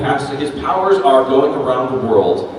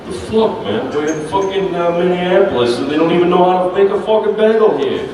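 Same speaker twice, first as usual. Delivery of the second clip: higher-pitched, griping New Yorker bagel; New York accent; 4 semitones higher than usual. Acted. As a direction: complain like an indignant New Yorker. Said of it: "would voice for Chirpy the Bagel".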